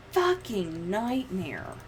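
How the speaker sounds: ordinary talk, nothing marked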